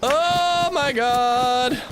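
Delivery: high, slow, stunned groan; drawn-out oh my god. Real, not acted. A streamer reacting to being shot, slow high disbelief. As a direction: let it stretch in disbelief. Just got killed in game.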